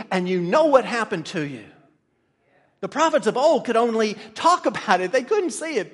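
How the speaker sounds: loud, emphatic pulpit delivery; fervent preaching. Real, not acted. Preacher telling the saved how blessed they are, fervent. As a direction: drive each phrase home with force.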